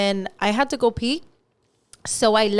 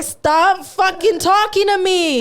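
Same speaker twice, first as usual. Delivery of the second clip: angry warning; 8 semitones higher, 4 dB louder, slower than usual. Retold or imitated. Filming creep, telling him off.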